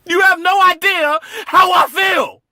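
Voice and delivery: very high, full-force furious scream; anguished outburst. Acted. Son raging at people claiming to know how he feels, screaming. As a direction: scream the injustice out at the top of your voice. Acted outburst at presumptuous sympathizers.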